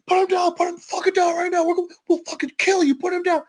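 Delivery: high-pitched, urgent commands; acted police shouting. Cops burst in ordering the man down, high urgent shouting.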